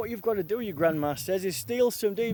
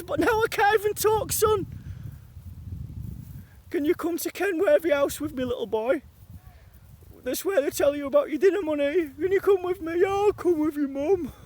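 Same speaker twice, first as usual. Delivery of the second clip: much higher, wailing mother voice; mock grieving mum; 5 semitones higher than usual. Mum calling her son to come with her, high mock wail. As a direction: wring every word out like a tearful mum.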